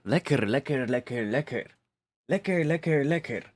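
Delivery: playful German voice, "lecker" bounced up high; German accent. Pretend. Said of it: "decided to do a German accent".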